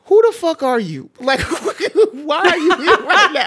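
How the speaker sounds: high, loud, laughing what-the; cracking up at the absurd thought; shrieking with laughter, incredulous; laughing disbelief. Retold or imitated. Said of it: "My thought was like".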